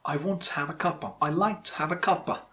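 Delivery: I want to have a cuppa, cup of tea squeezed into cuppa, said fast; saying cuppa. Shown as a demonstration. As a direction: say cuppa as one quick word. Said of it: "you can say".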